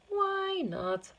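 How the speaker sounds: jolly shopkeeper. Acted. Jovial shopkeeper reply.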